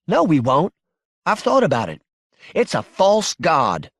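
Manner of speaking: high, emphatic despair; upset insistence. Acted. Wife refusing husband's comfort before she cries, upset.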